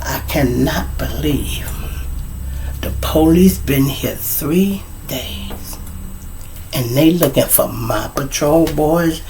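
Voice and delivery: slow, near-whispered disbelief; hushed disappointment. Acted. Miss Washington disappointed at her patrol boys, hushed disbelief. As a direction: hold it in, soft and drawn out. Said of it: "She was so upset and disappointed at us".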